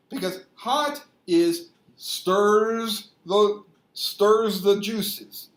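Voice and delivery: drawn-out, pointed delivery; slow emphatic. Real, not acted. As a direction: stretch each word to make the point. Dwelling slowly on stirs juices.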